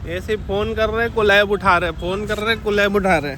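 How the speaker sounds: mock Bengali accent on Hindi words. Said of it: "Bengali accent में हिंदी में बोलता है". (बंगाली लहजे वाली हिंदी)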